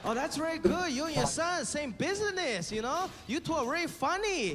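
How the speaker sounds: put-on aunt with Chinese accent. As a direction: play the proud, teasing Shanghai aunt. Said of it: "And he's like".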